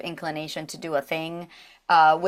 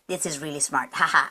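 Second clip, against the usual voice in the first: Sombra; 5 semitones lower than usual. Acted. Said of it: "Sombra's like".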